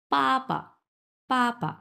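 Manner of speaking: পা pitched high, second পা short and light; neutral tone. Shown as a demonstration. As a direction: say পা firmly, then a light toneless পা. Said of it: "neutral tone".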